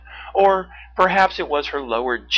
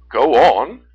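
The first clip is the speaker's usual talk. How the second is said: loud bear urging her on; Teddy; 2 semitones higher, 13 dB louder than usual. A narrator's reading. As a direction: prompt firmly as a big bear. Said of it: "Teddy the brown bear said".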